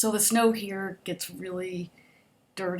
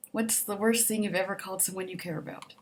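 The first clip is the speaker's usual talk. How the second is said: ordinary talk, nothing marked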